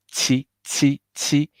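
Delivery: 七 with a wide mouth, pitched high. Shown as a demonstration. Teacher isolating the Q sound for students, wide-mouthed. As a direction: model the Q sound in 七, short and high. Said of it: "wide mouth".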